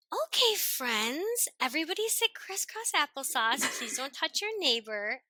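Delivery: high, singsong mock schoolteacher; good teacher personality. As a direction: round up little pupils, sweetly overdone and stretched out. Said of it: "a good teacher personality".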